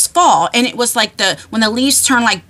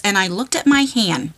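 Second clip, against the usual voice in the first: ordinary delivery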